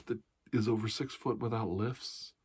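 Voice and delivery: ordinary delivery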